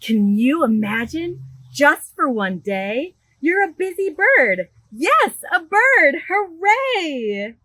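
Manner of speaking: bright, lively storybook read-aloud; animated picture-book reading; reading to children. Acted. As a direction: read it big for a room of little kids. Librarian reads picture book aloud.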